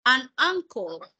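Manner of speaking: slow, careful "An uncle" with uncle said "ankle"; teacher models "An uncle" slowly for the class; model pronunciation. Shown as a demonstration. Teacher reading an example aloud, slowly and carefully. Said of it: "dos palabras similares, pero en este caso que inician ambas con vocal".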